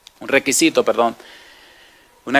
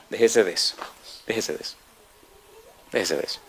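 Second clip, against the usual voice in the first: softer and lower each time; trailing-off preacher's dismissal; fading repetition; 5 semitones lower, 10 dB quieter, faster than usual. Real, not acted. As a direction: let the phrase die away. Repeated, fading rebuke.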